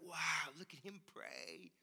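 hushed, awed onlookers whispering; onlookers impressed. Retold or imitated. Churchgoers murmuring in awe at a prayer. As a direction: gush quietly over the praying person. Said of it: "they're like".

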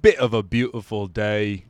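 slow Australian try slipping into English; botched Australian accent; attempted Australian accent. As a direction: draw it out in a shaky-aim Aussie accent. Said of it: "I keep doing English".

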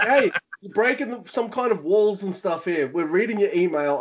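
wife catching him reading the email, mock exasperated; mock wife complaint. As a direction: lightly play the wife walking in annoyed. Voicing wife walking in on him.

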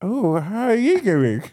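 slow, put-on gummy greeting; toothless woman. Toothless woman greeting a man at the bar, hammed-up. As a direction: flirt slowly as a toothless woman. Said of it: "she had no fucking teeth".

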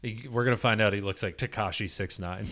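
ordinary delivery

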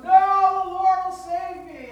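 very loud, high refusal; defiant shout. Acted. Man on the roof refuses the helicopter, shouting. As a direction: yell it out in stubborn faith.

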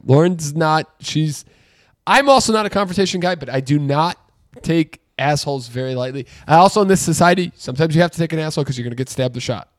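ordinary delivery

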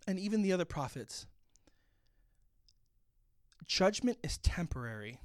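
usual speaking voice

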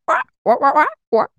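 high, whiny Three Stooges wah-wah; Curly impression. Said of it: "Curly from the Three Stooges".

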